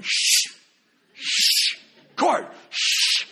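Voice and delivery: loud hissed shushing, then high; shushing old ladies. Retold or imitated. Old ladies hush the excited young man, hissed then high. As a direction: hush him like a scolding old lady. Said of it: "old ladies are going".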